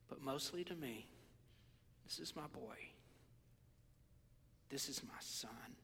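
very soft, nearly whispered; hushed, intimate declaration of a father; tender fatherly. Acted. Preacher voices God presenting his boy, hushed and tender.

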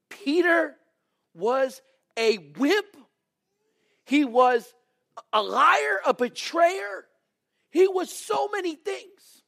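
high, full-voiced shout, words drawn out; impassioned, slow-hammered preacher's yell; thundering preaching. Real, not acted. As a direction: shout each accusation slowly from the pulpit. Preacher thunders Peter's failings.

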